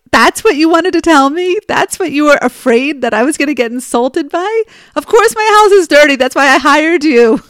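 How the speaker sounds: high, loud, laughing through the words; real amused disbelief breaking into laughter. Real, not acted. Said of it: "I just was laughing".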